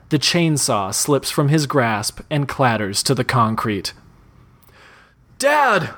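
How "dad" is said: high, breathless cry of Dad; breathless shout. Acted. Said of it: "Matt shouts breathlessly".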